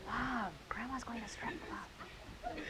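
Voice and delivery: imitating a worried child in a hushed voice; slow, whispery mock alarm; concerned grandson. Presenter retells grandson's worry to the audience, hushed mock concern. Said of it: "grandson was very concerned".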